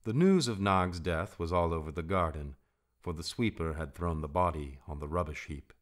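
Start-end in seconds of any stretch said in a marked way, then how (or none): none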